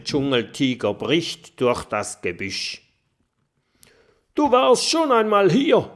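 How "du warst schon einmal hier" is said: much higher, much louder tiger snarl. A narrator's reading. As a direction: bark it at the little dragon like a huge tiger. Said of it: "herrscht er den kleinen Feuerdrachen an". (Tiger fährt den kleinen Drachen laut und drohend an)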